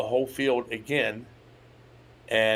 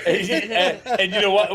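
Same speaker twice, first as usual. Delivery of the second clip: talking through laughter; high, laughing, loud; 9 semitones higher, 8 dB louder than usual. Real, not acted. Laughing while telling.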